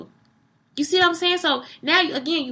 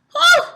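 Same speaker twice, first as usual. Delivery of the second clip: a sharp, high, loud whistle of a whew; overwhelmed whew at her beauty; 10 dB louder than usual. Real, not acted. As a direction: blow out a big whew of amazement. Reacting to the wife's beauty.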